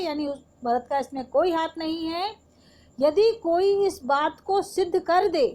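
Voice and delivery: usual speaking voice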